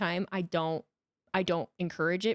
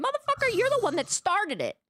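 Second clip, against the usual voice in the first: angry retort; 12 semitones higher than usual. Retold or imitated. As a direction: snap back hard at him. Snapping back at the bully.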